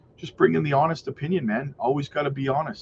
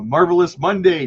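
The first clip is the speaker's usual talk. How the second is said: ordinary talk, nothing marked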